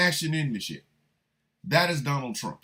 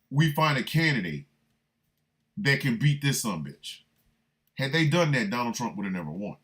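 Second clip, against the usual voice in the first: ordinary talk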